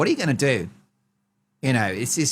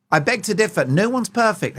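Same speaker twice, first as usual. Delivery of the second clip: snooty critic; 6 semitones higher than usual. Pretend. Voicing an imagined critic.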